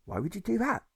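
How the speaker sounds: high suspicious challenge; wary challenge; challenging. A narrator's reading. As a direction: question his motives sharply. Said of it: "Water Snake challenged".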